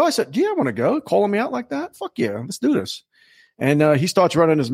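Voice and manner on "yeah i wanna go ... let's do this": high-pitched cocky retort; mock bravado. Challenger answering the host on stage, high mock bravado. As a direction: answer the dare, hamming it up. Retold answer to the host's dare.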